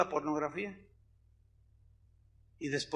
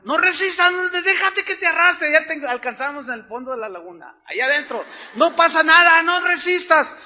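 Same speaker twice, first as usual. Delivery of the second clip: higher, louder call across the water; shouted warning; 8 semitones higher, 8 dB louder than usual. Retold or imitated. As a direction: yell to him over the current. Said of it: "ellos me gritaron".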